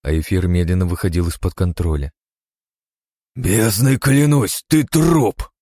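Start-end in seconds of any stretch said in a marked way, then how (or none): 3.3-5.5 high, loud, slow furious vow; furious threat. Acted. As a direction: swear vengeance with rage. Vowing to kill monster. (яростная угроза)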